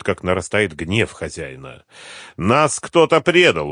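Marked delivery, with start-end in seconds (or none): 2.4-3.7 slow, raised, emphatic claim; with conviction. A narrator's reading. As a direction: state it loudly and with certainty. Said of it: "убеждённо сказал Мурат". (убеждённо, с нажимом)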